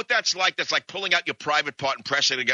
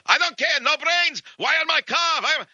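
put-on high-pitched yelling in a thick Russian accent; screaming Russian; 4 dB louder than usual. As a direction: rant like a crazed Russian whose bumper got hit. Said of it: "a crazed Russian".

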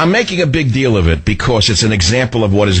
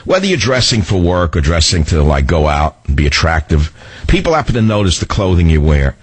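lower, easy pitchman read; radio ad read; 4 semitones lower than usual. Acted. Host selling shirts to his listeners, smooth pitchman tone. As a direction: sell the shirts like a friendly tip.

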